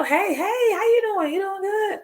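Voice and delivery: very high, sing-out hello; acted friendly greeting. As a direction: greet them in a gushing high pitch. Acts out their now friendly greeting.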